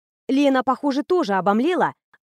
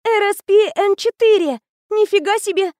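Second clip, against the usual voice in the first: put-on thrilled girl; 10 semitones higher, 6 dB louder than usual.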